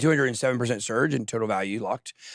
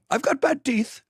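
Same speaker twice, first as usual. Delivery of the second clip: high, caricatured British voice; exaggerated British impersonation; British accent; 11 semitones higher than usual. Shown as a demonstration. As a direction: do a mock British accent, pitched way up. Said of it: "if I do a British accent".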